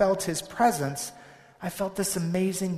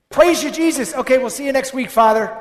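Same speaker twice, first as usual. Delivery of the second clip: high, loud chirpy farewell; bright sing-song send-off at church door; mock cheery churchgoer; 7 semitones higher, 12 dB louder than usual. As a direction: ham up a breezy parting to God.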